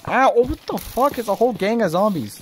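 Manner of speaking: high startled shout at zombies; startled yell. Real, not acted. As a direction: yelp as you get hit. Attacked by zombies.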